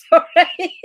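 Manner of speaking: sorry squeezed out through giggles; laughing apology. Real, not acted. On stream, apologising to viewers while laughing. Can't stop laughing on camera.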